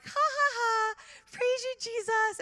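high, loud put-on laugh and praise; hammed-up, high-pitched jubilant voice; mock praising church ladies. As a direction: imitate gleeful church ladies. Imitates praying ladies laughing and praising.

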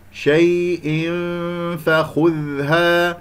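شيء فخذها very slowly, a long hum shaped toward the ف; hidden tanween. Shown as a demonstration. Teacher modelling ikhfa, slow hummed join of شيء and فخذها. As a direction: chant شيء, holding a nasal sound into فخذها. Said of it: "لدينا تنوين مع الفاء إخفاء".

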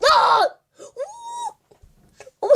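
usual speaking voice

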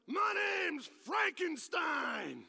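slow, high, full-throated yell; yelled introduction. Retold or imitated. Homeless drunk bellowing into the pastor's ear, yelling. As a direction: bellow the name, drawing it out. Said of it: "he yells in my ears".

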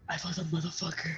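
very quiet, low, quick remark; hushed. Real, not acted. Player spotting an enemy in the game, hushed. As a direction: say it fast, under your breath. Same line repeated, first take hushed.

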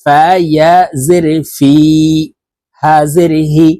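ordinary talk, nothing marked

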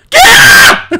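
high, loud startled cry; real fright turned to laughter; startled laughing shout. Real, not acted. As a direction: jump at the sudden bat. Startled by bat.

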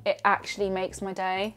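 ordinary talk

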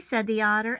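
usual speaking voice

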